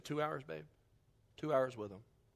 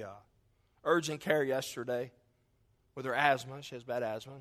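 ordinary talk, nothing marked

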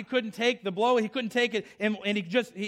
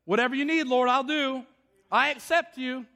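higher-pitched willing colt; surrendering colt; 4 semitones higher than usual. Acted. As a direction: voice a colt giving in gladly. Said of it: "And the colt said".

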